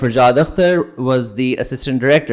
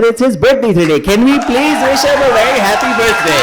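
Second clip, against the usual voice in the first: excited; 9 semitones higher, 3 dB louder than usual. Real, not acted. Announcing birthday to crowd.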